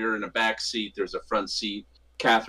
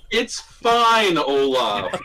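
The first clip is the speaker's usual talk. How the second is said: hammed-up, high and loud reassurance; mock-casual shout, pitched way up; 4 semitones higher, 9 dB louder, much slower than usual. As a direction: call it out over-brightly to the driver. Acted loud reassurance to the driver.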